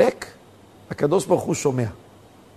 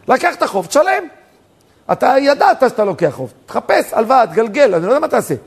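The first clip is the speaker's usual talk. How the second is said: raised, higher-pitched scolding; indignant rebuke; 5 semitones higher, 3 dB louder than usual. Acted. Indignant lender arguing against the crying debtor, heated rebuke. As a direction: protest heatedly, pushing the pitch up.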